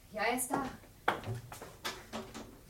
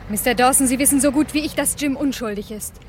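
louder, firm and accusing; heated insistence; 5 dB louder than usual. Acted. Wife insists to the detective her husband is innocent, heated. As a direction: stand your ground.

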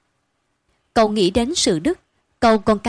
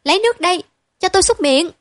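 much higher demanding yell; yelling child; 9 semitones higher, 5 dB louder, faster than usual. A narrator's reading. Spoiled boy yelling orders at his sisters. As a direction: bark the order out. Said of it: "rồi hét vang".

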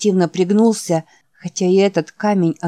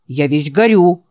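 louder whiny objection; protesting; 3 semitones lower, 5 dB louder than usual. Acted. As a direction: protest that you are burning up. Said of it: "протестовал Ричард". (протестующе, с нажимом)

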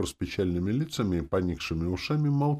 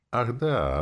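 joyful cry; 6 semitones higher than usual. A narrator's reading. Demoness suddenly reminded of a wish, joyful cry. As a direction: cry out as if happily remembering. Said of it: "радостно крикнула демонесса".

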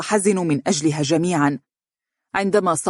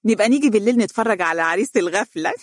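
higher, quick gossipy jeer; sly, mocking aside in a raised pitch; snickering mockery; 4 semitones higher, faster than usual. A narrator's reading. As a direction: make catty fun of the groom. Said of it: "وضحكت هي وابنتها ضحكة خافتة وقالت".

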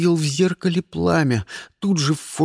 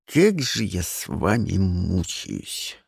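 slow, quiet words through clenched teeth; through gritted teeth; 8 semitones lower, 3 dB quieter, slower than usual. A narrator's reading. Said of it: "процедил я сквозь зубы".

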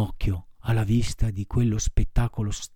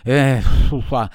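building sneeze; 3 semitones higher, 6 dB louder, slower than usual. Acted.

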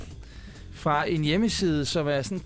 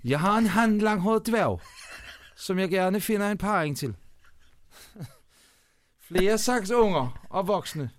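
slow, slightly raised Bornholm dialect reading; Bornholm accent; 3 semitones higher, slower than usual. Shown as a demonstration. Reading classified ads to a co-host on request, Bornholm accent. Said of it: "Kan du læse lidt op med en lille bornholmsk accent".

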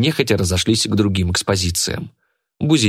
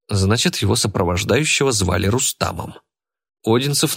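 plain reading voice

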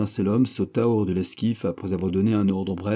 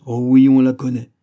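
joie vive; 4 semitones higher, 10 dB louder than usual. A narrator's reading. Le vieillard ravi.

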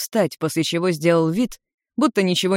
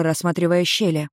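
ordinary delivery

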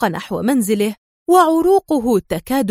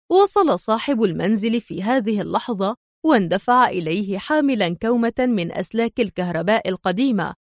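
ordinary delivery, nothing marked

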